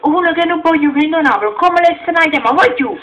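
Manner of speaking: loud, high, outraged protest; indignant shouting. Acted. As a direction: rant at him in disbelief. Heated comic argument, raised voice.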